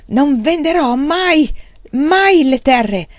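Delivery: furious shout, high, loud and very slow. Acted. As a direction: hurl each word at them. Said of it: "gridò verso di loro". (grido furioso e lento)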